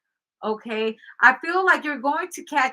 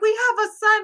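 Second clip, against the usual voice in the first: high pleading; 10 semitones higher, 4 dB louder than usual. Retold or imitated. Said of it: "she was like".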